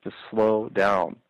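drawn out very slowly; slowing down. Shown as a demonstration. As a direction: stretch each word out, slow. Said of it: "as Chris Rock says".

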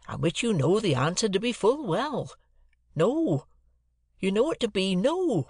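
laboured, roundabout earnestness; earnest rustic Joe. A narrator's reading. Joe's dialogue in narration.

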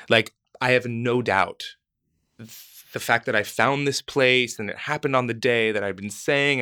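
usual speaking voice

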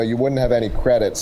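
ordinary talk, nothing marked

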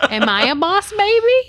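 high, loud squeaky question; squealed joke. Pretend. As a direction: squeak it out playfully.

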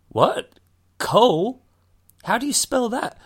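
high-pitched, baffled questioning; exaggerated puzzlement over a name; mock bafflement. Mimics students baffled by the name.

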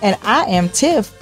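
ordinary talk, nothing marked

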